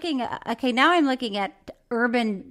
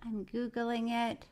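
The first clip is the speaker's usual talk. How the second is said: much softer, slow; muttered; 12 dB quieter, slower than usual. Real, not acted. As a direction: mumble while typing. Grudging while searching.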